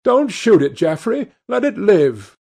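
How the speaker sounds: high, urgent plea; crying out. A narrator's reading. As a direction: cry out to stop him, high and loud. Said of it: "he cried out at once".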